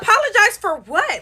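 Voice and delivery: very high, loud incredulous retort; indignant disbelief. Retold or imitated. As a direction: reenact an outraged comeback at full pitch. Retold outraged retort to bystander.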